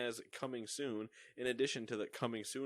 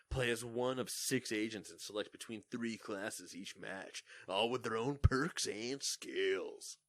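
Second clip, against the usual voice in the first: movie trailer voice. Acted. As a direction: announce it like a blockbuster trailer. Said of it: "we can trailer voice again for that".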